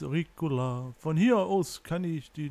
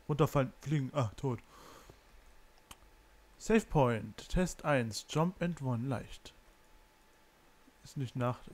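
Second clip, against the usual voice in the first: ordinary delivery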